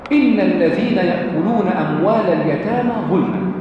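slow chanted recitation of the verse; measured recited verse, drawn out. Acted. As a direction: intone it like tajweed reading. Teacher recites verse as example.

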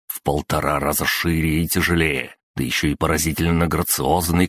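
ordinary delivery, nothing marked

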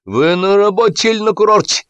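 high, scolding cry; outraged shouting. A narrator's reading. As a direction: yell it as an indignant reproach. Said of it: "закричал Сапфиров".

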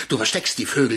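usual speaking voice

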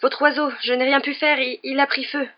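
put-on a boy gabbling that the bird caught fire; panicked. A narrator's reading. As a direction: stumble through the excuse in a panic. Harry panics over burning bird.

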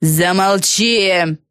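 long drawn-out cry of exasperation; restrained yell. Acted. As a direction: drag the word out, fed up. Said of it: "закричала Фелисити". (протяжный, несильный раздражённый крик)

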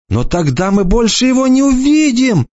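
put-on tearful girl pleading for her brother. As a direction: plead with him, hopeful. Bronwyn's voice.

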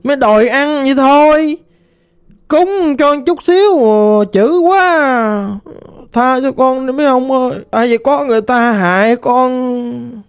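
lightly voiced ghost girl, plaintive and mournful; a hint of a lost spirit lamenting.

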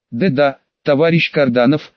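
ordinary delivery, nothing marked

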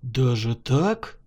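low, slow question of surprise; mildly amazed; astonished. A narrator's reading. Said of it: "поразился Тишин".

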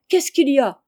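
high, loud, eager question; elated shout, demanding news; overjoyed cry. A narrator's reading. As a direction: burst out with excited curiosity. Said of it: "au comble de la joie".

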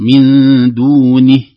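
"دونه" very slow and loud, with a full kasra on the nun; correct pause. Shown as a demonstration. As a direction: stretch "من دونه", sounding the kasra clearly. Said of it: "ينبغي عليه أيضًا أن يحقق". (وقف صحيح مع تحقيق الكسرة)

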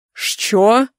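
mildly barked. A narrator's reading. Caller barks into the phone, mildly gruff. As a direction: throw it back as a gruff question. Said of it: "гаркає у слухавку".

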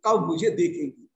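low, subdued lament; retold anguish. Radha longing to see her beloved, retold anguish. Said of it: "फिर से व्याकुल होने लगती हैं".

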